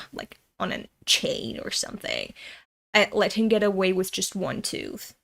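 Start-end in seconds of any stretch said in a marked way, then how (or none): 0.1-2.3 hushed, menacing afterthought; whispered aside. Acted. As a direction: whisper the grim boast.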